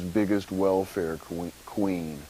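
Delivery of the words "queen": ordinary delivery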